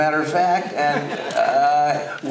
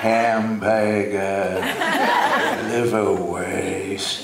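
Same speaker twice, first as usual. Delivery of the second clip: slow, drawn-out Upper East Side accent; Harpo; 4 semitones lower, much slower than usual. Shown as a demonstration. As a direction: stretch each word in old Upper East Side New York speech. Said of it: "an Upper East Side accent".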